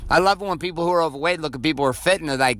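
normal talking voice

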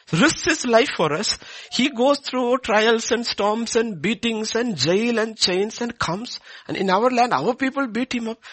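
high, loud, slow fervent preaching; rising, loud pulpit fervour; full-force preaching. Acted. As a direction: preach it out to the congregation with fire.

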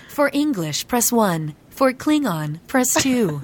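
mock recorded menu voice; automated phone menu. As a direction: play the phone robot offering Klingon. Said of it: "next time go like".

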